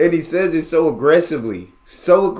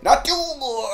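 mock German "Natur", pitched way up; exaggerated emphasis; 13 semitones higher than usual. Reactor trying to copy the German, "Natur" hammed up high. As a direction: stretch the end of "Natur" in a high put-on voice. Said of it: "You gotta really emphasize parts of the word".

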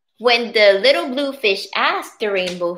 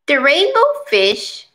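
plain reading voice

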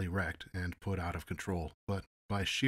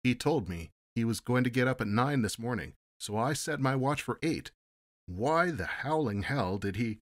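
higher and louder, rising indignation; protesting; 5 semitones higher, 5 dB louder than usual. A narrator's reading. Security agent blurts excuses at his boss, indignant protest. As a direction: pile on the excuses until cut off. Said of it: "he protested".

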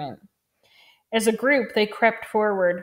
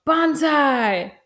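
higher-pitched narrated yell; yelled battle cry; 5 semitones higher, 4 dB louder than usual. A narrator's reading. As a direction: yell it like a charging boy soldier. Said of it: "jumped out from where he was hiding and yelled".

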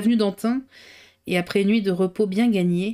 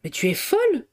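retort; 7 semitones higher than usual. A narrator's reading. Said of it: "ripostait Jacques".

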